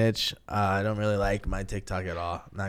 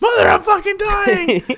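very high, loud panicked shriek; re-enacted hysterical yell; freaked-out yelling; 9 dB louder than usual. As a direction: scream to Mother in hammed-up terror. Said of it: "I'm freaking out, yelling at my mother".

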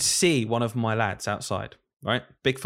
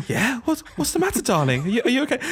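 much higher, sing-song concerned mother; worried mum; 12 semitones higher than usual. Retold or imitated. Teacher voicing a mum checking on her child, high and fussing. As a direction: coo at the child like a fretting mum. Parent voiced replying.

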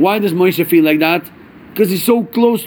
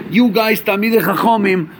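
ordinary delivery

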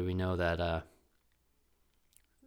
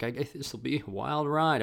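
wry foreboding; 6 semitones higher than usual. Retold or imitated. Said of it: "And I was like".

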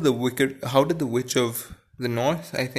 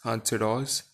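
usual speaking voice

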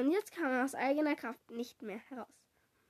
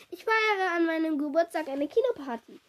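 put-on higher, louder girlish announcement; excited squeal; 4 semitones higher, 5 dB louder than usual. A narrator's reading. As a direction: squeal the party news, moderately excited. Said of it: "quietschte sie". (aufgeregt quietschend)

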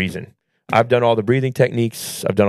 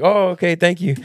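breezy, higher-pitched mock thanks; nonchalant thanks; 8 semitones higher than usual. Parents handed their forgotten child, imitated nonchalantly. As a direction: casually thank him, as if it were nothing. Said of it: "so nonchalantly".

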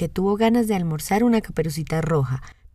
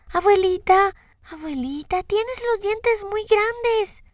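niña aguda y muy lenta, con miedo; niña asustada; 14 semitones higher, slower than usual. A narrator's reading. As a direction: pregunta temerosa de niña. Voz de Caperucita asustada. (niña con miedo)

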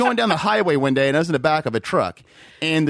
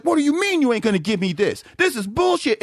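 indignant complaint, pitched up toward the end; mock angry customer; 5 semitones higher than usual. Scammer refused free food by staff, mock indignation. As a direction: imitate an entitled scammer getting mad. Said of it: "they start getting mad and be like".

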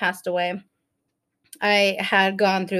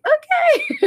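mock nurse okay; 3 dB louder than usual. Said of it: "She's like".

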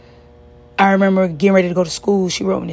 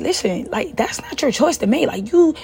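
higher-pitched acted-out objection; retold indignant protest; 6 semitones higher than usual. Daughter objecting to mom hiding her hospital stay, retold indignant protest. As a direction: re-enact pleading your case, pitch up.

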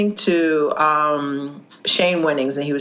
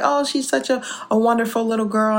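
imitated high-pitched cooing compliment; gushing praise; 6 semitones higher than usual. Ladies praising a little girl to her mother, imitated sweet gushing. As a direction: coo it high, mimicking admiring ladies.

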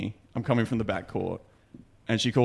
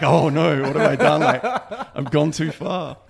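laughing mock panic; 4 semitones higher, 7 dB louder than usual.

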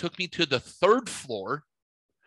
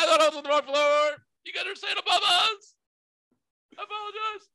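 laughing apology. Retold or imitated. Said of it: "I'm like".

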